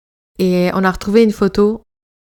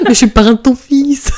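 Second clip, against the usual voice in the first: louder, laughing mock film line; François Cluzet; 3 semitones higher, 6 dB louder than usual. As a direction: ham up a dramatic actor line. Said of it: "On dirait François Cluzet".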